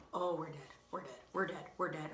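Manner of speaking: low, hushed imitation of dread; doomed little brother. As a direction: murmur it softly like a kid who knows he's in trouble. Brother sees the damage, doomed.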